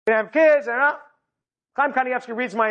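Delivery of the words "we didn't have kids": raised-pitch, louder helper's repeat; repeating for hard hearing. Retold or imitated. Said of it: "because he can't hear so well".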